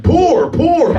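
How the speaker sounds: high, laughing mock sympathy; mocking pity. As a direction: pity them in a high sing-song. Mocking the passengers boarding.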